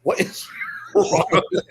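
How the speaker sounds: exasperated, laughing it off; laughing scold. Retold or imitated. Melinda laughs at his prank.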